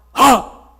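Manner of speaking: a sudden explosive Ha, very loud and high; sharp shout. Shown as a demonstration. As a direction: startle the room with one bark. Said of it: "Made a shout".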